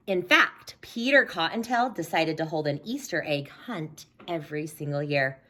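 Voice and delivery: ordinary delivery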